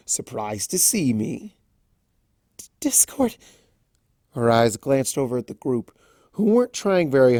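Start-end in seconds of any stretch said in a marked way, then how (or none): 0.1-1.6 slow, sly trickster voice; teasing Discord. Acted. Discord sneaking up on Fluttershy, sly and slow. 2.6-3.4 breathless, high whispered stammer; startled whisper. Acted. Fluttershy startled by Discord, whispering his name.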